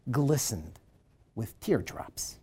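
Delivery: ordinary talk, nothing marked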